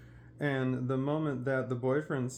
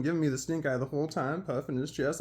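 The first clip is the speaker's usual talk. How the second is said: usual speaking voice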